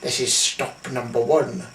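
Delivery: low, scheming Grinch voice, hissed; a sly villain muttering to himself. Acted. Grinch on the first rooftop, low sly hiss. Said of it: "the old Grinchy Claus hissed".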